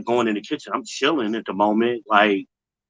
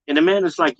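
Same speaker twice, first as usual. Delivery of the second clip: usual speaking voice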